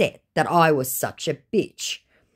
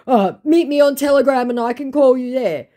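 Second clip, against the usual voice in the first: high, louder mocking imitation; hammed-up scammer; 10 semitones higher, 7 dB louder than usual. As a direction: parody the scammer pleading. Voicing the scammer's plea.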